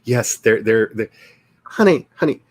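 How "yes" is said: ordinary talk, nothing marked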